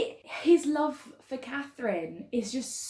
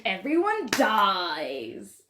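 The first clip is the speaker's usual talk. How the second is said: very drawn-out mock-dramatic announcement; slow, melodramatic flourish; theatrical relish; 4 dB louder, much slower than usual. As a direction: stretch it out with hammed-up tragic glee. Hammed-up relish of tragedy.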